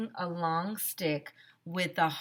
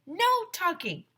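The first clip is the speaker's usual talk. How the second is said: teacher's order; 13 semitones higher, 5 dB louder, slower than usual. Acted. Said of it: "Mister Cooper told us".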